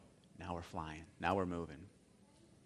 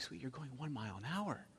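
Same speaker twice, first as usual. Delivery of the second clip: reassuring; 3 semitones higher, 12 dB quieter than usual. Retold or imitated. Dad reassuring frightened daughter.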